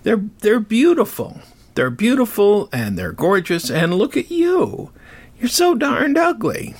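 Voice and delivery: high, mocking voice laughing at him; mocking god. A god mocking Hephaestus, high and taunting. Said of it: "He says".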